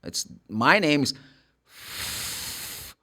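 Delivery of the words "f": long drawn-out hissing "F-"; sustained unvoiced "F-" sound; letter sound demo. Shown as a demonstration. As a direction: hold "F-" as a pure airy consonant, no vowel. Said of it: "the sound of the f".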